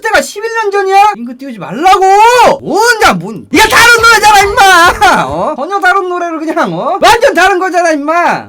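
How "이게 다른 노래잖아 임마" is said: a higher, louder outburst at chat; exasperated shout. Real, not acted. (채팅에 버럭 소리치기)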